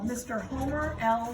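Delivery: plain reading voice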